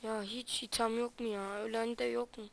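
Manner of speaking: very soft, hushed voice while hiding. Real, not acted. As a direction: keep it low so nobody hears. Hiding from enemies in game.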